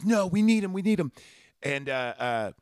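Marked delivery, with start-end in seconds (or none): 0.0-1.1 higher pleading repeat; lightly voiced John pleading; pleading kid. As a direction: beg higher, insisting. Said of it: "And he goes".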